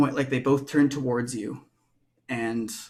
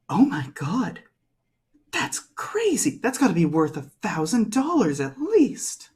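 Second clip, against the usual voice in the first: mock amazement; 7 semitones higher than usual. Tiffany hyping the fake violin to the buyer, amazed. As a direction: voice jumping high on crazy. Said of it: "Tiffany's there and goes".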